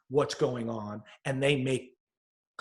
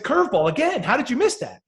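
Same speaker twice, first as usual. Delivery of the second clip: high, raised-voice mock frustration; exasperated coach; 9 semitones higher, 4 dB louder than usual. Coach to batter who missed a curveball, exasperated. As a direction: scold in baffled, acted frustration.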